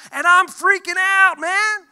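high, loud panic over "I'm freaking out"; panicked outburst. Retold or imitated. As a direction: cry it out in a rising childhood panic. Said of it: "I thought".

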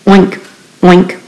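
usual speaking voice

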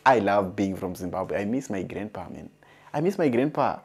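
ordinary talk, nothing marked

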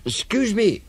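high-pitched, polite little voice; polite elephant child. Acted. Elephant child addresses the crocodile, high and polite. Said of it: "said the elephant child most politely".

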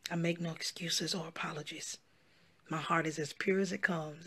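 hushed, intense whisper. Real, not acted. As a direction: whisper it firmly. Whispered declaration.

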